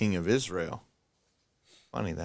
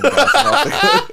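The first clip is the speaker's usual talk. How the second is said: loud, laughing through the punchline; 13 dB louder than usual. Real, not acted. Mocking the boastful king's cliff massacre, laughing loudly. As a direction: cracks up on the last words.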